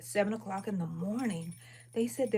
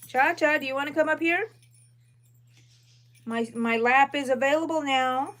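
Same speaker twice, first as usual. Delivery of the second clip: high, louder call to the dog; 5 semitones higher, 6 dB louder than usual. Real, not acted. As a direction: coax the dog up onto her lap. Calling the chihuahua up.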